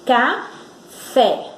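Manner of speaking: "Café" split by a long pause, fé pushed; stressed syllable demo. Shown as a demonstration. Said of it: "assoletra o fé com mais força".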